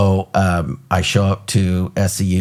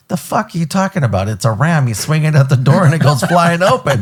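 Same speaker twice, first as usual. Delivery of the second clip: mocking disbelief; 8 semitones higher than usual. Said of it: "it already brought out the worst in me".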